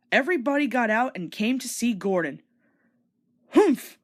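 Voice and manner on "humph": high, loud scornful humph. A narrator's reading. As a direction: huff it out disdainfully. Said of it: "said the fat director".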